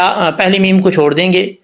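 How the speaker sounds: usual speaking voice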